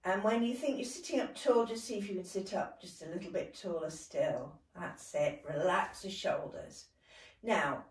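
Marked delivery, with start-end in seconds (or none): none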